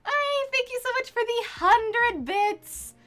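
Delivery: excited. Real, not acted. Thanking for bits excitedly.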